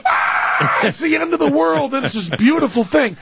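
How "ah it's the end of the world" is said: breathless high cry of awe; awestruck cry. Retold or imitated. A man overwhelmed by the eclipse, crying out in awe.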